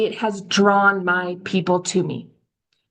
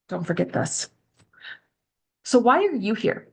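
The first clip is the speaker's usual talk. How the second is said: ordinary talk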